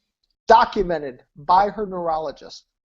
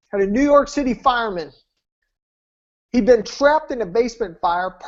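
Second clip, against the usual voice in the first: ordinary talk, nothing marked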